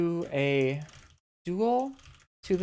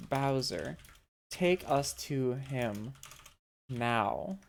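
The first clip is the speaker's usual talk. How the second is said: threatening; 4 semitones lower, 4 dB quieter than usual. Acted. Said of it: "getting in here threateningly".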